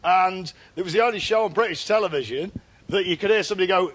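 ordinary delivery

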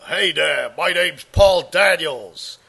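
exaggerated American accent, slow; hammed-up American showman, stretching the words; Paul Daniels. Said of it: "a little bit of Americanism".

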